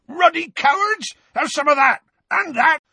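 high, rough fighting roar; furious bellow. A narrator's reading. Hagrid striking at his attackers, furious bellow. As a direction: bellow in bursts as you swing. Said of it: "bellowed Hagrid".

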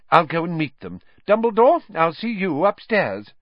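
high, loud official's voice; Fudge. A narrator's reading. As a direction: announce it loudly, pitched high.

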